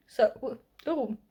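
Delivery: hiccuping mid-sentence; interrupted by a hiccup. Real, not acted. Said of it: "I got the hiccups apparently".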